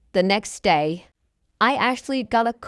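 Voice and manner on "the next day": plain reading voice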